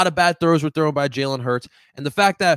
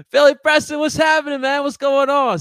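loud excited greeting, pitched way up; hyped shout-out; 10 semitones higher, 8 dB louder than usual. Real, not acted. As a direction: shout a hype welcome to a viewer. Loud greeting to chat viewer.

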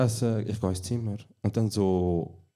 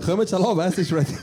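high, loud call through the flat; laughing mock call-out; 9 semitones higher, 5 dB louder than usual. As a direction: yell to everyone that dinner's here, laughing. Acting flatmate calling about food.

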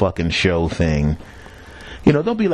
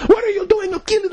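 angry outburst. Retold or imitated. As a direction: blow up at him in a high voice. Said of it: "she just goes off".

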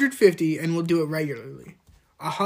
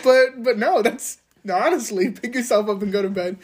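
mock protest; 5 semitones higher, 6 dB louder than usual. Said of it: "I can't be like".

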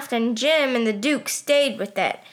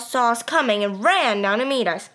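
ordinary delivery

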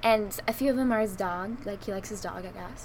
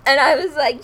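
ordinary talk

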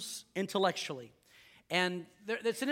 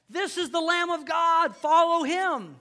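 higher, louder prophetic call, slowing at the end; proclaiming; 9 semitones higher, 6 dB louder than usual. Retold or imitated. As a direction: announce it to the crowd like a prophet. John the Baptist proclaiming Jesus.

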